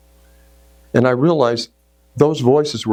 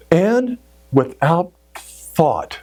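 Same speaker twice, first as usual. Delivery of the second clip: ordinary talk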